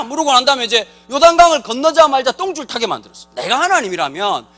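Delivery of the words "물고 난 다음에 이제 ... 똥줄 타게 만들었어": ordinary delivery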